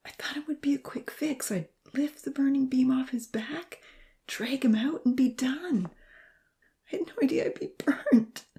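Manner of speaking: dark, anguished murmur pitched high; pained, half-whispered regret. A narrator's reading. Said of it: "Darkly, he murmured".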